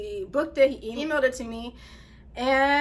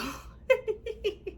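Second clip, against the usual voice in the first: high, light giggling; amused laugh; 9 semitones higher, 4 dB quieter than usual. Real, not acted. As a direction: giggle helplessly at his message. Amused by his self-deprecating message.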